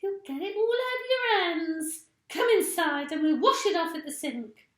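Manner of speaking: put-on high, loud bossy old wife; Missus Twit. A narrator's reading. Missus Twit orders her husband to wash at the sink, high and loud. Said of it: "cried Missus Twit".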